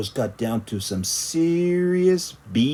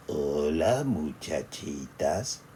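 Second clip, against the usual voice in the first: much lower and softer, slow; 7 semitones lower, 6 dB quieter, slower than usual. Acted. Skippy John greeting his sisters very softly. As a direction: murmur it sweetly. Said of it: "in a muy, muy soft voice".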